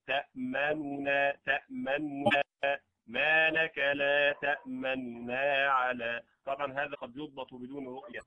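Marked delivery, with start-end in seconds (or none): 0.1-2.4 تأمننا with the u of the first nun cut short; snatch the vowel in تأمننا; ikhtilas on تأمننا. Shown as a demonstration. Teacher isolating the word تأمننا for students. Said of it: "الإتيان بثلثيها". 3.1-6.2 very slow, تأمننا with a snatched u on the nun; recited with ikhtilas. Shown as a demonstration. Teacher repeating the ikhtilas reading for students. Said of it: "فتقرأ".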